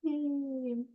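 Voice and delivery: high, drawn-out little yay; soft cheer. Real, not acted. Host applauding a panelist's introduction, soft cheer.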